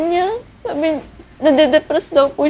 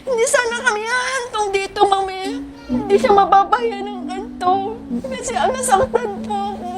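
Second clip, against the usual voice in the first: higher, tearful pleading with sobs; crying plea; 8 semitones higher than usual. Retold or imitated. Bride reliving her plea to the groom, crying. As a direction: plead through tears, voice breaking.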